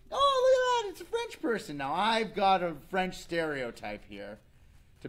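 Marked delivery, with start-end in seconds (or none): 0.1-1.8 very high, loud mock gasp; hammed-up surprised exclamation; mock surprise. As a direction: feign delight at spotting him. Mock surprise at French stereotype.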